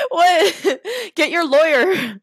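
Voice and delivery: high-pitched laughing outburst; incredulous laughing. Real, not acted. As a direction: squeal it through real laughter. Laughing at absurd assault scene.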